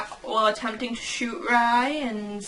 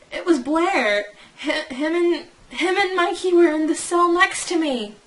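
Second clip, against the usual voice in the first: higher, urgent character line; high-pitched acted quote; 6 semitones higher, 3 dB louder than usual. Character reporting Blair is in prison, high-pitched. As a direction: read it pitched up, like reporting news.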